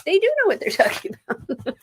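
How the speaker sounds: laughing admission. Real, not acted. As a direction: giggle it out. Laughing at own joke.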